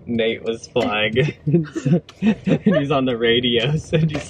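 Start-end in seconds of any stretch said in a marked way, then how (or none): 1.4-2.2 loud real laugh; burst of laughter. Real, not acted. Laughing at his own story. 2.2-4.3 words squeezed through laughter; laughing while talking. Real, not acted. Laughing through his own story.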